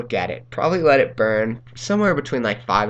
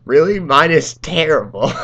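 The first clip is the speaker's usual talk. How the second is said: higher, louder mock outrage; mad mom imitation; 5 semitones higher, 8 dB louder than usual. Mom complaining about her s'more, mock-angry outburst. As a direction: play an indignant mom complaining. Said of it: "she was so mad".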